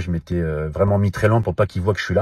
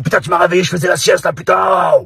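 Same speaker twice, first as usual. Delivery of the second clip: high, loud angry yell at being woken; woken man yelling; 9 semitones higher, 5 dB louder than usual. Retold or imitated. Stranger in the car yells at Ragnar for waking him, loud and angry. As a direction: bark it out like a man torn from his nap. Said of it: "Et là, le mec, il me regarde, fait".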